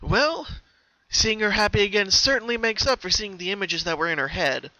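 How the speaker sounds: put-on worried young pony; Twilight voice. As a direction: check gently on a friend.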